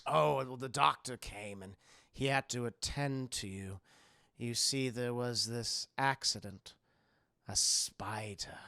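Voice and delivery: hushed and measured, pausing between phrases; creepy old host feigning sympathy; sinister host. Retold or imitated. Howard explaining with a false story.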